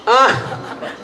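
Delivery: loud, high laughing shout; burst of laughter. Real, not acted. Audience member's voice joke.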